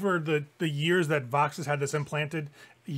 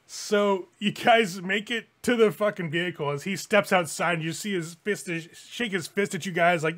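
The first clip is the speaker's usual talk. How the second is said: usual speaking voice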